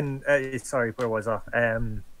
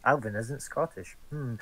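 quiet musing; 4 semitones lower, 10 dB quieter than usual. Acted. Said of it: "And I'll be like".

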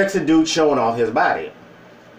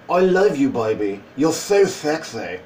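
put-on British sweet talk; British accent; 2 semitones lower than usual. New boyfriend wooing Miss Berry, imitated British accent. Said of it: "including the accent".

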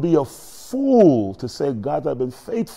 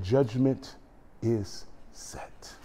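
very soft, halting near-whisper; grieving verdict; 4 semitones lower, 13 dB quieter, much slower than usual. Retold or imitated. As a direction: pronounce it sorrowfully, hushed, with a pause. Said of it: "She said he was crying".

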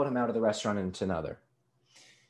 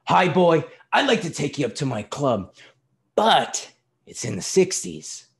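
loud, higher voice of a hearty father; 6 semitones higher, 5 dB louder than usual. A narrator's reading. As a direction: boom out a greeting to the boy. Father's hearty greeting in story.

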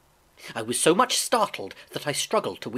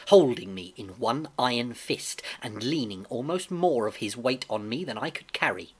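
ordinary delivery, nothing marked

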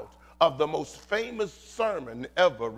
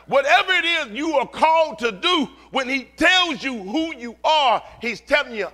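high, loud, ringing exhortation; full-force preaching; 13 semitones higher, 10 dB louder than usual. Real, not acted. Preacher telling the church God is with them, impassioned preaching. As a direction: proclaim it hard to the whole room.

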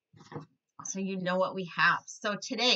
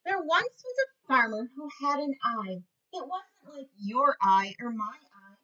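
lively storybook voice for little ones; reading to children; 5 semitones higher, 5 dB louder than usual. A narrator's reading. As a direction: read it like a teacher at story time. Reads picture book to kids.

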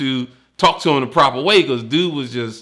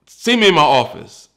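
louder command, a bit higher; stern order; 4 semitones higher, 8 dB louder than usual. Retold or imitated. Lieutenant ordering the recruit, stern loud command. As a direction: order him like a superior.

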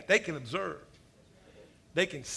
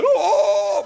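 higher, louder forced mock wail; fake wail; 10 semitones higher, 11 dB louder than usual. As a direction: ham up a failed wail. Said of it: "trying to build up to a wail".

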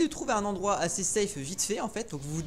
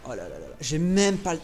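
ordinary talk, nothing marked

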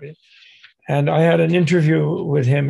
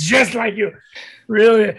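higher and louder, a playful shout; mock shout; 5 semitones higher, 4 dB louder than usual. The lama shouting at the interviewer to scare him, loud mock shout. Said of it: "And he shouts".

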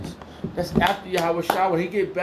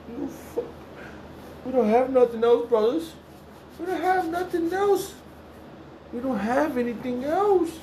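raised high, pleading; high pleading cry; 11 semitones higher than usual. Real, not acted. Preacher crying to the brothers, high pleading voice.

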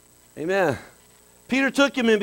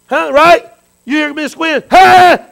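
calling out; 6 semitones higher, 6 dB louder, slower than usual. Real, not acted. Calling to congregation.

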